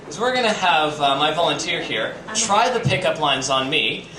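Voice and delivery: ordinary talk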